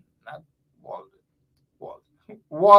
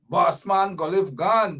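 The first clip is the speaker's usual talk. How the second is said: ordinary talk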